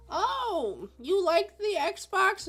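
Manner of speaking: high, slow doddering grandma voice; out-of-touch grandma. Retold or imitated. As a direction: play the clueless grandma. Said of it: "a grandma or, like, a parent who's not really in touch".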